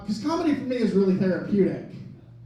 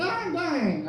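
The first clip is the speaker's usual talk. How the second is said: mock dramatic kid; 8 semitones higher than usual. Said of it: "a super dramatic little kid".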